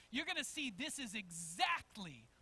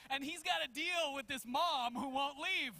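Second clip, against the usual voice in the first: normal talking voice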